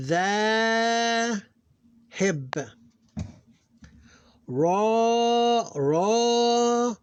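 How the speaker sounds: long vowels held high and steady; syllable-by-syllable recitation. Shown as a demonstration. Teacher reading Arabic syllables aloud, measured recitation. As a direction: chant each syllable apart, stretching long vowels.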